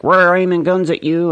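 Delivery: loud, high threatening voice; mock Russia. Vlogger voicing Russia as a loud, high threatening bully. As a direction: bark it like a cartoon superpower. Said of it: "Russia's like".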